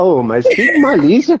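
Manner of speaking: put-on slow, mildly shocked old puppet; a hint of a scandalized grandpa; Robinson McAllister. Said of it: "aqui está Robinson McAllister".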